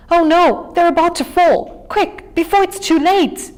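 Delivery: high, loud frantic cry; panicked wail. Acted. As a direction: wail as if the rocks are falling. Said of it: "continued to wail".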